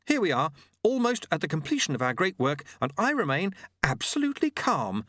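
high, keyed-up character voice; Jeremy insisting he's calm. Acted.

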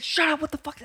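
high panicked hiss-whisper; hushed panic. Retold or imitated. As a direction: hush him in a panic. Friend hushing him.

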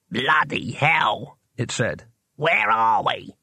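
slow, odd little demon voice, grumbling; a cranky tiny painter demon; grumpy imp. A narrator's reading. The imp poking out of its box, slow and grumbling.

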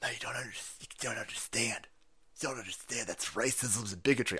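mock whisper. Mocking the protesters' objection.

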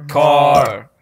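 loud mock shout. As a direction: belt it out. Shouted word game.

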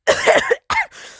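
high, hacking choke; choking cough. Acted. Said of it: "they were choking".

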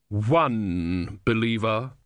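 very slow, dry put-down; belittling Sea Queen. A narrator's reading. Sea Queen belittling Om's single believer, slow and dry. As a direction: let each word sink him.

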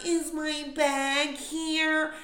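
high, loud, very slow whiny mimic; mocking oblivious traveler. Mocking people bent over, high whiny drawl. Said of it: "the worst part is the ones".

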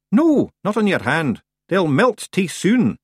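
high, slow dialect voice; Rob Anybody. A narrator's reading. Rob instructing Tiffany about the flakes, high and slow. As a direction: pitch it up and draw out the words.

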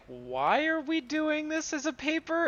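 high, fast, baffled editor voice; puzzled editor. Retold or imitated. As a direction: ask it like a confused boss. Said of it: "the editor at one point is like".

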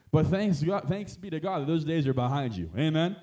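loud, ringing praise shout; triumphant closing sermon praise; preaching at full force. Acted. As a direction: lift the room into celebration.

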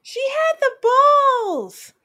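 stretched falsetto-like exclamation; high, drawn-out echo. Pretend. Co-host echoing the praise of her nerve, high drawn-out exclamation. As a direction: sing it out high and slow. Co-host echoes the line admiringly.

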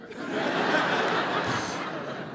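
breathy laugh, pitched high; high airy laugh. Real, not acted. Amused at her own chocolate advice, a high giggle. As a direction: let a light laugh slip out.

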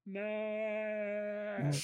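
high, held, comically weak cry; feeble imitated scream. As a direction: drag the name out as a thin background yell. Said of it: "a lot quieter and sounds way worse".

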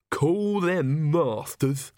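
slow, venomous sneer; sudden venom. A narrator's reading. Igor scorns his new masters, slow and venomous. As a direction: spit the word masters with contempt. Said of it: "with sudden venom".

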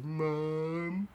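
put-on butter her up like a little kid; imitated sweet-talking son; kissing up. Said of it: "kiss up to my mom's".

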